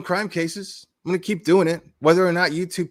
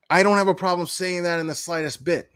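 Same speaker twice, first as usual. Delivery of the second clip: normal talking voice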